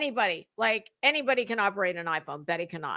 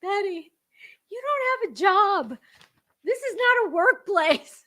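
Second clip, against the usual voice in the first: pitched way up, scolding Betty; exasperated high retort; 9 semitones higher, 5 dB louder than usual. Acted. Answering Betty's email, high and incredulous. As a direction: talk back at her in a high, incredulous voice.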